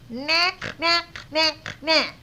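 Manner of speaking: short snorted bird noises; snorting bird. A narrator's reading. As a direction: snort out each "knack" like a bird. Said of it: "snorted the flugel bird".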